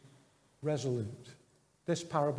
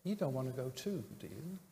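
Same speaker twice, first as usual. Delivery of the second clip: softer, fading almost to nothing; hushed and wounded, trailing away; quiet, hurt Jesus; 8 dB quieter than usual. Acted. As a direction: say it softly as Jesus to departing friends. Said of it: "tone of voice in which Jesus said that".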